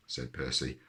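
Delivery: usual speaking voice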